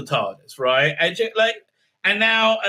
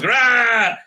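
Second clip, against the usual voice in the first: mock growl; 7 semitones higher, 4 dB louder than usual. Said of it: "so he growls".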